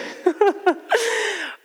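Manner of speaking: high, breathy burst of laughter at herself; rueful laugh. Real, not acted. Pastor laughing at her own hard year. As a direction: laugh off how hard last year was.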